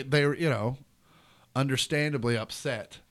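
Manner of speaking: ordinary talk, nothing marked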